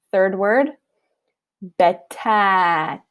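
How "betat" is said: "betat" with a short fatha and a long drawn alif. Shown as a demonstration. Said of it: "Listen to the difference between the length".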